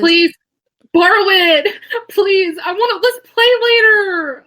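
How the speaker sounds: high-pitched pleading squeal; excited begging. Real, not acted. Begging friend to borrow the game.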